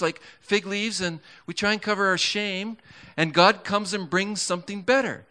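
high, driving sermon delivery; fervent preacher building to a climax; impassioned preaching. Real, not acted. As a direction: preach it with mounting urgency, pitched well up. Preaches with rising fervor.

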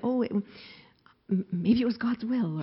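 soft, halting imitation of a comforter; faltering, tentative start; hesitant suggestion. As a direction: tiptoe into the suggestion, slowly at first.

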